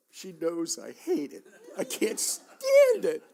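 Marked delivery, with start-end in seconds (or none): 1.8-3.2 a much higher, louder, slow laughing whine; laughing cry. Real, not acted. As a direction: break into a laughing wail of disgust. Laughing at his own disgust.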